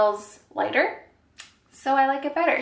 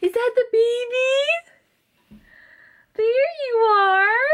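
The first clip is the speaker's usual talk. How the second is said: voicing the baby; 11 semitones higher, 4 dB louder than usual. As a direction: speak for the baby, wondering. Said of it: "She's like".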